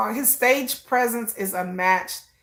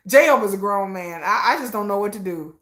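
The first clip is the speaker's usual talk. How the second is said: usual speaking voice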